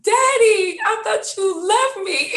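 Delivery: put-on a small child wailing at her dad; crying little girl. Toddler at daycare crying to her daddy as he arrives.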